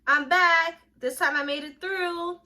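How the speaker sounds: high, loud, excited hello; triumphant greeting. Acted. Greeting old instructors on coming back, triumphant. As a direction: call it out proudly, drawing out the words.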